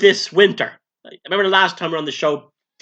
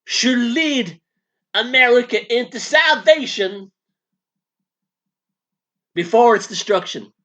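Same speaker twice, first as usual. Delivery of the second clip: southern preacher; 4 semitones higher than usual. Acted. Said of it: "now you sound like a southern preacher".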